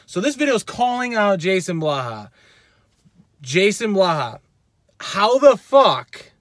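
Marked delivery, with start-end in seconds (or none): 5.0-6.3 slow furious emphasis. Real, not acted. Angry rant calling out lifter.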